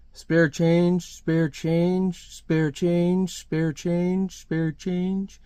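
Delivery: droning monotone beggars' refrain; zombie-like chant of panhandlers; begging crowd. Acted. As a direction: repeat it evenly like an overlapping crowd, gradually fading. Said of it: "They're all asking for money at once".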